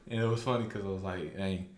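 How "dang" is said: usual speaking voice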